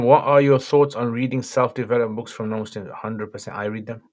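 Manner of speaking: normal talking voice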